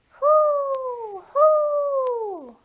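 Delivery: high, loud owl hoot. Acted. Imitating the owl in rhyme.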